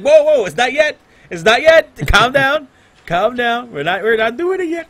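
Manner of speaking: high and loud, waving the crowd back; playful yell to calm the eager crowd; mock panicked hype. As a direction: hold them off, laughing and excited. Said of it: "I'm like".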